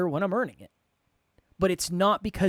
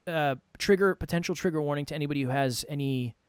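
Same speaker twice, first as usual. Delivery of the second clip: ordinary talk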